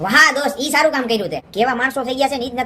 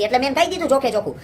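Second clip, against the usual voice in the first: ordinary delivery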